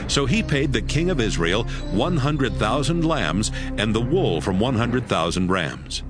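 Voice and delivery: ordinary talk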